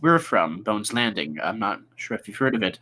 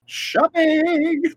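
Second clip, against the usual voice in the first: high, delighted squeal of the word; squealed shopping cry; 3 dB louder than usual. Acted. Friend squealing with glee about going shopping. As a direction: squeal it like a shopaholic.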